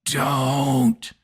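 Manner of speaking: hammed-up creepy lean-in into the mic; mock Biden whisper. Host mocking Joe Biden at the microphone, creepy pretend whisper. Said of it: "creepily leaning into a microphone and whispering".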